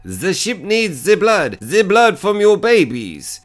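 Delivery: possessed captain in a hammed-up German accent; mock German accent. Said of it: "taken over by the German guy".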